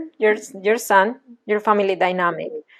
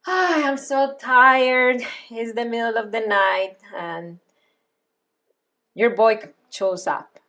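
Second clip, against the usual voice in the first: slow, soft, worn-out sighing voice; tired mother at night; 4 dB quieter than usual. Acted. Coach playing Crystal, exhausted, slow and soft. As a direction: drag the words out like an exhausted mom at midnight. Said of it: "What about if you are your son and I'm Crystal?".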